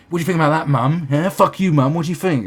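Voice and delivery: loud, high goading shout; angry teenager. Acted. Rebellious kid provoking liberal mum, loud and defiant. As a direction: jab at mum, trying to provoke.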